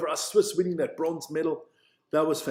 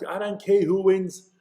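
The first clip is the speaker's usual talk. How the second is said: usual speaking voice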